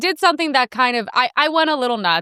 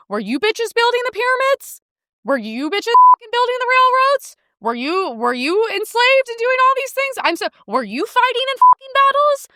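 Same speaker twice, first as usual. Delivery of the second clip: angry rant; 10 semitones higher than usual. Real, not acted. Comedian ranting at TikTok women, high and heated. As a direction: fire off the questions, pushing up.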